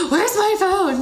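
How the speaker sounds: high, loud, put-on mom voice; panicky mom. Mother frantically searching for the phone in plain sight, high and loud. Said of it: "It'll be, like".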